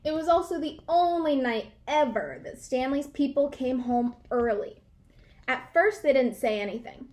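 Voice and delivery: usual speaking voice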